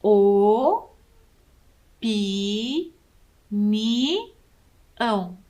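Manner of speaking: each syllable O, pi, ni, ão said apart and stretched; syllables spelled out. Shown as a demonstration. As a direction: break opinião into O-pi-ni-ão, one mouth opening per syllable. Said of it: "quantas vezes eu abro a minha boca". (sílabas bem separadas, O, pi, ni, ão)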